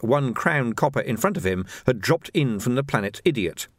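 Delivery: usual speaking voice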